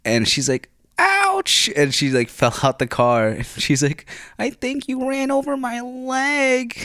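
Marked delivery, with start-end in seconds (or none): 1.0-1.7 high squealed ouch, imitating the girl; hammed-up pained yelp; mock yelp of pain. Girl run over by car. 4.4-6.8 put-on high, slow, dazed girlish voice; mock dazed passenger; imitating the girl. Injured girl stating it.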